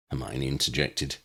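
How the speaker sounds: ordinary delivery, nothing marked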